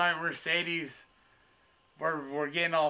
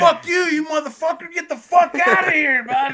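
much higher, much louder screaming; retold furious yell; 11 semitones higher, 9 dB louder than usual. Waitress kicking the hecklers out, furious scream. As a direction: scream the whole table out.

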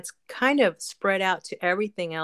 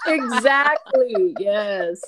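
drawn-out laughing exactly; 3 semitones higher, 5 dB louder, much slower than usual. Real, not acted. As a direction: laugh through the word. Laughing agreement.

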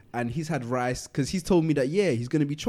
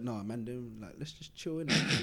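mumbled quote; 4 semitones lower, 12 dB quieter than usual. Retold or imitated. Drunk friend wants to chill.

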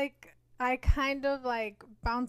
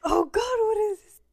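excited; 9 semitones higher, 9 dB louder than usual. Real, not acted. Excitedly forgetting the astrologer's name.

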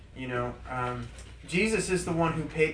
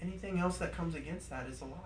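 much softer, gentle and pleased; calm joyful; 11 dB quieter than usual. Retold or imitated. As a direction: quietly tell him you're proud. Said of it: "he just very calmly, very joyfully".

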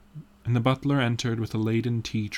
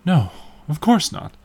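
higher, louder eager denial; raised, emphatic reassurance; crying out; 6 semitones higher, 6 dB louder than usual. A narrator's reading. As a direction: call it out brightly in quick protest. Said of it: "cried out Dorian".

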